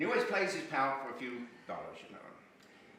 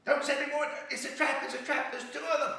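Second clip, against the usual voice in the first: high, urgent warning; panicked report from the battlefield; a beaten soldier pleading, high and urgent; frantic soldier; 12 semitones higher, 6 dB louder than usual. Acted. Wounded soldier reports back.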